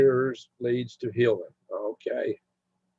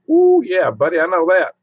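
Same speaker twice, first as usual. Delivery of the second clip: loud, high whoop into hearty agreement; emphatic agreement; 6 semitones higher, 8 dB louder than usual. Real, not acted. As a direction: whoop it out, knowing it all too well. Reacts to high wood prices.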